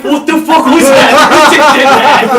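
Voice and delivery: high, loud disbelief; startled passers-by. Retold or imitated. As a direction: imitate spooked bystanders, laughing through it. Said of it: "the people are like".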